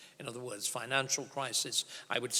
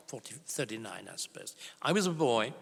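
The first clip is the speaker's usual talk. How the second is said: ordinary talk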